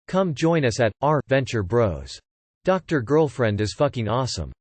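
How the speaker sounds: usual speaking voice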